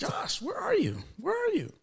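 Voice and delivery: put-on small, very high toddler voice, softly; high-pitched mimicked toddler call; two-year-old girl. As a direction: imitate a little child calling Dad in falsetto. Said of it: "At two years old".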